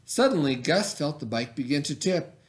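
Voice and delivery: put-on reading a picture book aloud to children; story reading. A narrator's reading. Teacher reading a bike story to her class, storytelling voice. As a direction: tell it gently, like story time.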